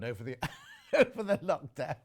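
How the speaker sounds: words broken by a laugh; laughing while reading. Real, not acted. Presenter reading a viewer's weight jibe, laughing through it. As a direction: crack up mid-sentence and carry on.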